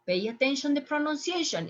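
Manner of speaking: ordinary talk, nothing marked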